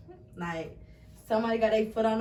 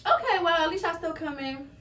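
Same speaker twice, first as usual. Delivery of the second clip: resigned okay; 6 semitones higher than usual. Retold or imitated. Student told of delayed visit, resigned but accepting. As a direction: shrug it off, mildly disappointed. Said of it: "So I was like".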